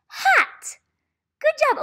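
high loud bright cheer; excited praise. Real, not acted. Teacher cheering a child who read hat, excited. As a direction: celebrate the right answer.

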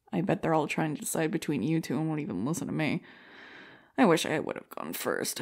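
ordinary talk, nothing marked

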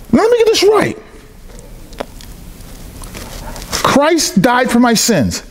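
high, slowed-down imitation of astonishment; amazed realization. Acting out man grasping the gospel.

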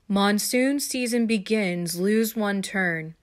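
slow, deliberate game-card announcement; measured, drawn-out read-out; reading aloud. A narrator's reading. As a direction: spell out the message word by word. Game message read out from board.

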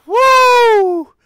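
very high, loud woo; celebratory whoop. Real, not acted. Fan celebrating the big win, victory whoop.

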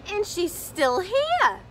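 breathless little bear announcing the intruder; high loud baby bear voice; Baby Bear alarmed. A narrator's reading. Baby Bear finds Goldilocks.

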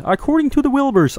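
high, nasal-free froggy puppet read; Kermit voice. Pretend. Streamer reading a game note as Kermit. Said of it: "I don't know why I'm doing Kermit".

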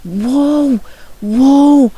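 high loud wowed exclamation; be blown away; amazed whoa. Real, not acted. Gamer wowed by what he sees on screen, loud whoa. Reacts to texture pack.

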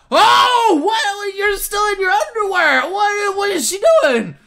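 high, loud burst of alarm; shocked outcry. Acted. He opens the door on her undressed, shocked outcry. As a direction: exclaim in shock, pitch shooting up.

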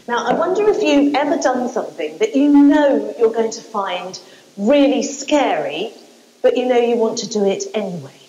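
ordinary delivery

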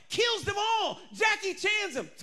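excited shout. Real, not acted. Preacher excited.

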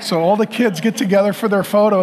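normal talking voice

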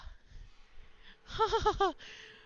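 shrill, breathless laugh burst; real high giggle; high squealing laugh. Real, not acted. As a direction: lose it at the silly line. Laughing at dumb dialogue.

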